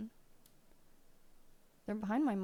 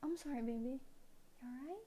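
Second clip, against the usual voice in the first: softer, gentle voice; tender apology; 3 semitones higher, 10 dB quieter, faster than usual. Real, not acted. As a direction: apologise to the cat softly. Soothing cat.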